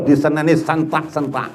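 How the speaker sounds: usual speaking voice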